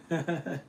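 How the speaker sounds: high little chuckle; short, high giggle trailing the joke; real laugh. Real, not acted. Streamer tickled by a viewer's question, high chuckle.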